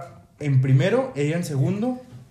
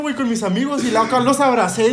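usual speaking voice

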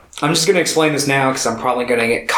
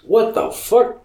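mock bewilderment; 7 semitones higher, slower than usual. Voicing puzzled Goku.

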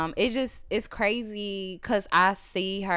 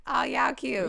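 mock West Coast accent; 6 semitones higher, 5 dB quieter, slower than usual. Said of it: "but it's just like".

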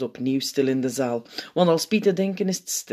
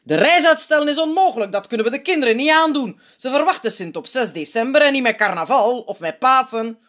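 high, indignant objection; verontwaardigd; 9 semitones higher, 5 dB louder than usual. A narrator's reading. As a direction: reject it out of hand, vehemently. Opperpiet indignantly rejects the proposal. (fel verontwaardigd)

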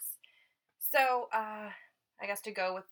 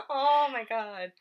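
laughing; 4 semitones higher, 11 dB louder, slower than usual. Real, not acted. Reacting to being called [name].

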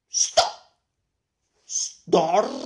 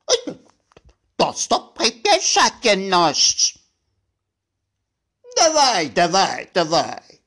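loud, pushing chant; loud urging; 11 dB louder than usual. Acted. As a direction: urge them on loudly.

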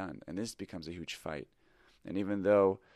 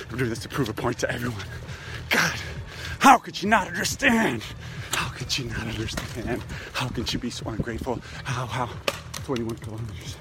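loud, high, self-pitying rant while running; shouting how wronged you are; victim mode; 3 semitones higher, 10 dB louder than usual. Real, not acted. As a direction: vent it all out, aggrieved and hurt. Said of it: "So this is me in the victim mode".

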